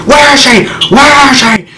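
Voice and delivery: very loud, high-pitched demanding yell; furious shout. Retold or imitated. Jealous husband grabbing the concierge, furious shout. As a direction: bellow it like you've grabbed someone by the collar. Acted assault on the hotel concierge.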